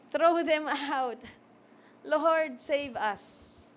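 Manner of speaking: slow, drawn-out plea; crowd's plea. Acted. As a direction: stretch each word as a pleading crowd. Crowd wanting the Romans out.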